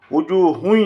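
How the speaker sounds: উজুহুন ending in a held nasal "hui"; merged tanween with ghunnah. Shown as a demonstration. Teacher showing how it must be pronounced, nasal merge. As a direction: turn the final n of উজুহুন into a nasal y. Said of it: "এরকম উ-, উচ্চারণ করতে হবে".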